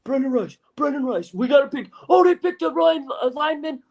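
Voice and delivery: high, whiny mock fan complaint; high pleading fan imitation. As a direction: whine it like a sulking fan. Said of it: "all day on Sunday or Saturday".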